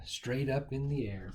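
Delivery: usual speaking voice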